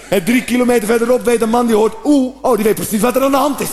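usual speaking voice

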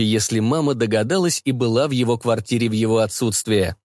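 ordinary delivery, nothing marked

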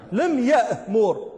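لم يأمر drawn out slowly, word by word; slow demo. Shown as a demonstration. Teacher slowing down for students learning the rule. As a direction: spell out لم يأمر at half speed. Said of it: "هكذا؟".

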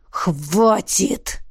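dragon's gruff order to stop; stern growl; growled command. A narrator's reading. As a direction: growl it to end the bickering. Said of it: "рыкнул дракон".

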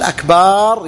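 أكبار with a long, rising "baaar"; lengthened ba. Shown as a demonstration. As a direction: hold the final vowel of أكبار well past normal. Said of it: "مد الباء".

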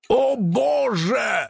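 high, drawn-out cry of dismay; horrified cry. A narrator's reading. Karych clutching his heart in horror, crying out. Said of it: "закричал он".

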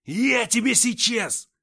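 slow, furious high yell. Acted. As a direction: roar a threat at him. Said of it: "заорал он".

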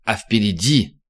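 ordinary delivery, nothing marked

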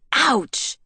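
sharp yelp of pain as the palm is cut; ouch. Acted. Bella yelps as a branch gouges her palm.

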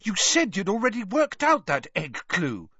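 high-pitched indignant Hermione. A narrator's reading. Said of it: "said Hermione indignantly".

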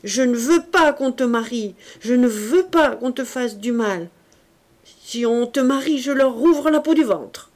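a frenzied, threatening shout. A narrator's reading. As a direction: shout his refusal in mounting panic. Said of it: "il haussait la voix".

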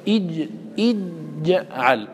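slow اجعل with the sound stopped on ج; strong blocked jeem. Shown as a demonstration. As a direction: hold the ج of اجعل shut, then release. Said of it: "فيها شدة".

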